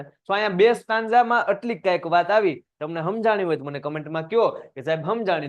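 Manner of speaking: normal talking voice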